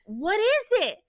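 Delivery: high, pressing mock question; insistent husband. Husband asks wife again.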